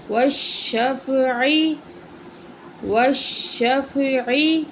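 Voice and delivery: every sound stretched very slowly; slow blended reading. Shown as a demonstration. Teacher joining syllables into a word for the pupil, very slow. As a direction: draw the joined word out carefully. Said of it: "تو پڑھتے ہیں".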